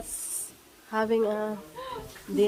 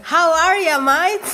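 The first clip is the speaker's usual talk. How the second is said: a playful try at an Australian greeting, raised and loud; mock Aussie "How are you, mate?"; Australian accent; 5 semitones higher, 7 dB louder, slower than usual. Shown as a demonstration. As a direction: put on an Australian accent for a friendly hello. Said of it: "I'm trying to talk in Australian".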